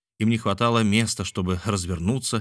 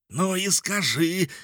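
high, drawn-out mock yell; yelled demand; 11 semitones higher, slower than usual. A narrator's reading. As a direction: wail the demand, pitch way up. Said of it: "завопил Николь". (протяжный вопль)

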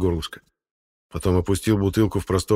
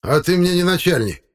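very high-pitched, loud retort; defiant; 11 semitones higher, 5 dB louder than usual. Acted. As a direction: snap back that he can't order you. Electrician defies the chairman. (дерзко, высоким голосом)

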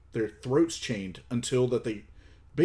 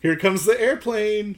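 put-on high, sing-song baby talk; playful spoon-feeding; 10 semitones higher, 5 dB louder than usual. Co-host playing the feeder offering a spoonful, high playful voice. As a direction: coax a baby to open up.

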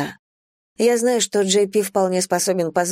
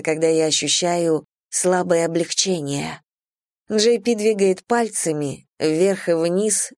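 ordinary delivery

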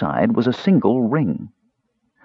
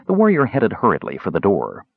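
ordinary delivery, nothing marked

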